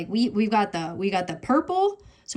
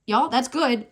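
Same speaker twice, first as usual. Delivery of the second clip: ordinary talk, nothing marked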